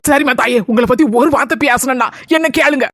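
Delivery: ordinary talk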